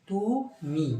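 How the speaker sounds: তুমি with the final ই held long and high; full final vowel. Shown as a demonstration. Teacher showing students the fully voiced তুমি. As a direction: stretch the ই of তুমি fully, rising then falling. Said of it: "পুরোপুরিভাবে উচ্চারিত হচ্ছে".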